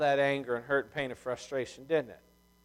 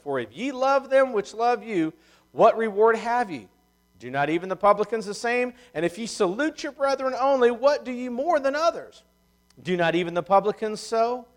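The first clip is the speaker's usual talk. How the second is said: higher, louder pulpit reading; preached scripture reading; 6 semitones higher, 6 dB louder than usual. Real, not acted. Preacher reading scripture to the congregation, raised declaiming voice. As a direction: declaim the verses with force.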